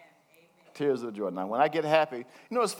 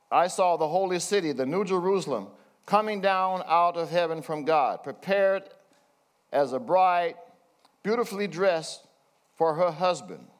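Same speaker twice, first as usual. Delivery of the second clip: raised, measured Bible reading; scripture reading; 4 semitones higher than usual. Acted. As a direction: read the verse out like from the pulpit. Reads scripture aloud.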